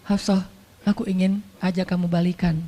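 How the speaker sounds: softly asking a wife to come back; gentle, tender invitation; romantic and soft. Acted. Husband gently asks his wife to come back, tender and soft. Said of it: "Romantis nggak sih?".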